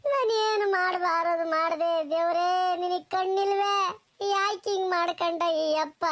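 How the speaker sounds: sing-song weeping cry to God; keen it out like a grieving wife; wailing lament. Acted. Wife wailing to God over her poisoned husband, sing-song lament.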